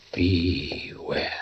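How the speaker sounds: faint breathy whisper of warning; eerie whisper. A narrator's reading. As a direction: breathe the word like a ghostly warning. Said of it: "something that said".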